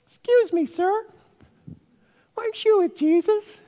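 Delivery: put-on very high, squeaky child voice; little slave girl. Slave girl questioning Peter by the fire, tiny high voice. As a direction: pipe up like a small girl with a question. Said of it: "a little slave girl".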